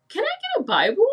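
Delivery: high-pitched, swooping question; squeaky-high imitation of asking; tentative retold request. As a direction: ask it way up high, like blurting a request. Retold sudden request to her manager.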